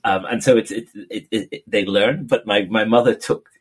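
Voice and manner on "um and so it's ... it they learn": ordinary talk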